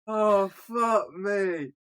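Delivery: long drawn-out, high groan of despair; exasperated. Real, not acted. Host worn down by shark movie talk, exasperated. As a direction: drag the curse out slowly, pitched high. Exasperated at co-hosts' film mix-up.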